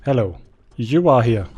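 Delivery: ordinary delivery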